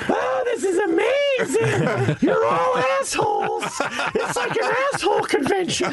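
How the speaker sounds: hammed-up falsetto impression of his wife; imitating wife. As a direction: shriek it like a delighted wife. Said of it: "Is that how she sounds?".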